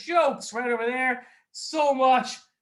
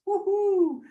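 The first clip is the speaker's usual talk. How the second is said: high-pitched celebratory whoop; excited cheer; 12 semitones higher, 4 dB louder than usual. Acted. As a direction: whoop it up for the prize. Cheering for raffle tickets.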